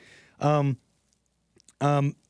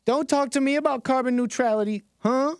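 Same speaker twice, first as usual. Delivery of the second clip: imitating Mother Nature in a high, accented voice; exaggerated, much higher-pitched mock accent; put-on sassy accent; 9 semitones higher than usual. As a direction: play her with a hammed-up accent. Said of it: "I don't know why I gave her that accent".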